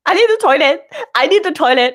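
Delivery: mock panic. Friend in the queue desperate for toilet, mock panic. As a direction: imitate someone panicking, close to laughing. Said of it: "He's panicking".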